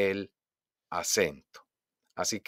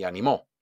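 "animó" with the weight on MÓ; stress on final syllable; 2 semitones lower than usual. Shown as a demonstration. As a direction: hit the last O of "animó". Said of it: "Entendamos la diferencia entre". ("animó" con acento en la O final)

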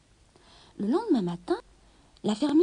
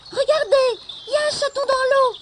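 put-on high excited child's shout; 13 semitones higher, 7 dB louder than usual. A narrator's reading. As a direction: call out loud like a little boy. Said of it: "cria un petit garçon".

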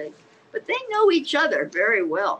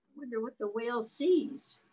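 plain reading voice

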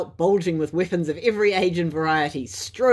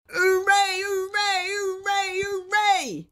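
a roomful of weasels whooping; cheering crowd; much slower than usual. A narrator's reading. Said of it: "they heard".

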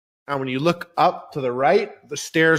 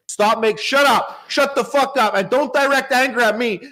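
angry shut up; 7 semitones higher than usual. Acted. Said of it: "You sound motivated".

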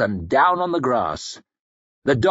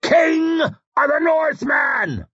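a long, high, drawn-out war scream; slow, high battle cry at full voice; 14 semitones higher, slower than usual. Acted. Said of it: "he screamed".

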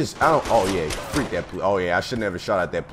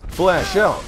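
raised, higher callout; loud callout; 9 semitones higher, 6 dB louder than usual. Real, not acted. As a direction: shout the flashbang warning. Calling out a flashbang.

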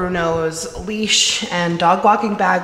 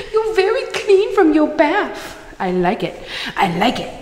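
much higher, sing-song puppy voice; 8 semitones higher, 5 dB louder than usual. Real, not acted. Owner cooing at her dog after his bath. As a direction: squeak it out to the dog.

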